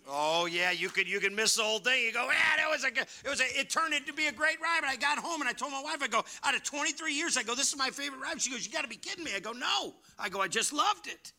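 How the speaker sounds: loud, building to a high-pitched exclamation; excited retelling. Retold or imitated. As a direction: gush at high pitch about a great ride. Excitedly retelling his favorite ride.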